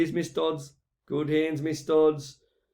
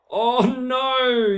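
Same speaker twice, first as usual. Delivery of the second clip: Oh, no. yelped high and loud; mock dread; 7 semitones higher, 6 dB louder than usual. Commentator bracing for the kid getting hurt, high yelp. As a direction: wince before the hit.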